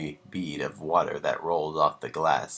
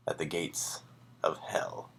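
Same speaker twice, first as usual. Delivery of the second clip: whisper; 10 dB quieter than usual. A narrator's reading. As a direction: whisper the last words like a secret. Story's ominous final line.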